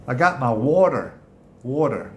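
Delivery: imitated American "water" with a rounded R; American accent. Shown as a demonstration. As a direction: play an American asking after their water. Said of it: "with an American accent".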